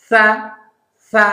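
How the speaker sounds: "সা" as thin th; tongue just between teeth for "সা"; tongue-tip THA. Shown as a demonstration. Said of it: "জিহ্বাটা একটু দেখা যায়". (জিহ্বা দেখিয়ে পাতলা "সা")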